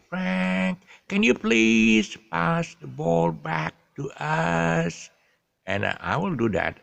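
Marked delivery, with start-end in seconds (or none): 0.1-5.1 high, drawn-out mock British child calling out; British accent. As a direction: put on a singsong British accent, high-pitched. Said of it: "with a British s-, accent style".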